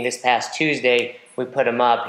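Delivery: ordinary talk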